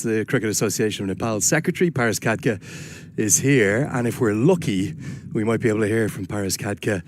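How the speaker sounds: ordinary talk, nothing marked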